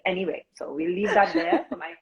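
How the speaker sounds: ordinary talk, nothing marked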